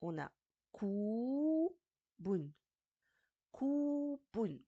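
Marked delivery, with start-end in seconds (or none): 0.7-2.5 "ku" held long and high, then "bun"; long vowel. Shown as a demonstration. Teacher sounds out word by syllables. 3.5-4.6 "ku" held long and high, then "bun"; long vowel. Shown as a demonstration. Teacher sounds out word by syllables.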